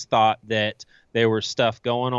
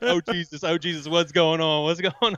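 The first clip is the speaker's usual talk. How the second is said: imitating a panicked classmate, pitched up; mock scared pothead; 5 semitones higher than usual. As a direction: play the stoner freaking out, a bit hammed-up. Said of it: "he is scared to death".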